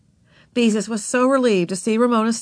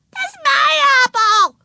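very high, loud shriek; child's scream; 7 dB louder than usual. A narrator's reading. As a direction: scream like a little girl whose treat is snatched. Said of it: "screamed Ramona".

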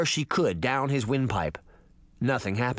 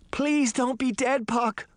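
put-on high, pleading little girl's cry; 11 semitones higher, slower than usual. A narrator's reading. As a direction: beg desperately, voice climbing high. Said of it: "the little girl cried".

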